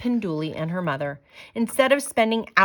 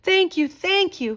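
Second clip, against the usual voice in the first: much higher, louder creature voices; grateful creatures calling; 12 semitones higher, 5 dB louder than usual. Acted. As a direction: squeal thanks as little creatures. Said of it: "called the creatures".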